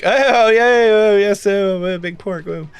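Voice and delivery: loud high fake cheer, fading to a mumble; mock revelry that starts big and dwindles; feigned revel. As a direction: pretend to party along, trailing away. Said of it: "feign revel with them".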